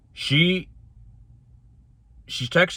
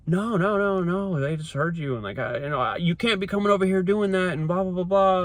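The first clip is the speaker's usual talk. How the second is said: higher, dismissive imitation of her; mocking the ex; 9 semitones higher than usual. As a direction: rattle it off like her brushing him off. Ex's dismissive scolding voiced.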